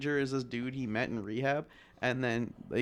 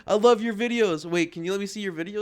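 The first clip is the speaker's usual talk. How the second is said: high, loud imitation of a fan; gush, then ask eagerly; mock-enthusiastic client voice, pitched up; 7 semitones higher, 6 dB louder than usual. Imitates a gushing would-be client.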